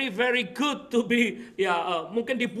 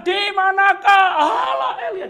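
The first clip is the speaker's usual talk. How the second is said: very loud, high desperate yell; shouted cry; 9 semitones higher, 12 dB louder than usual. Acted. Elisa striking the river with the cloak, huge high shout. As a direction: hurl the cry out at full power. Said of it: "dia teriak".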